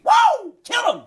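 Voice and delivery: high, loud fan yelling at the screen; screaming at the TV. Retold or imitated. Wife yelling at true crime show like a sports fan. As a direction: cheer for the killer like at a game. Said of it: "She screams at the T V".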